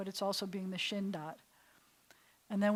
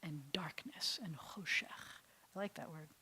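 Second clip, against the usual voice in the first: whisper; 4 semitones lower, 10 dB quieter than usual. Shown as a demonstration. Whispering darkness in verse.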